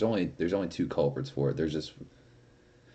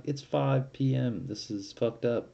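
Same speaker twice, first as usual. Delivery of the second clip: incredulous; 7 semitones higher, slower than usual. Retold or imitated. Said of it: "I was like".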